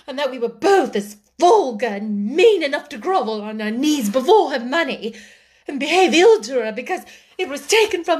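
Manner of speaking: high, loud, overwrought accusation; emphatic, worked-up outpouring; hysterical tirade. A narrator's reading. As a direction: rail at your sister, close to hysterics. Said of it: "made her hysterical enough".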